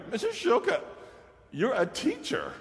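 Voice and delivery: hammed-up high boy's voice. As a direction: pitch way up to play Travis. Teacher voices Travis, punchline.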